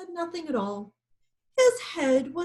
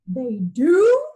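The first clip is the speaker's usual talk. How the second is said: incredulous; 10 dB louder than usual. A narrator's reading. Lion incredulous.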